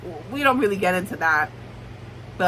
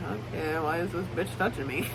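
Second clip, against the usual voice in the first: lower, much softer aside; muttered inner thought; 4 semitones lower, 10 dB quieter than usual. Acted. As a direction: think it aloud under your breath, imitating him. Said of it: "looking around the crowd like".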